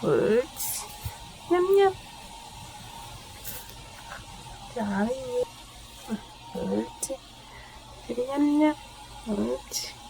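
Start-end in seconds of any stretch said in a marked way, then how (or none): 1.5-10.0 squeaky praising a dog. Real, not acted. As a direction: coo praise to a small dog. Praising dog during brushing.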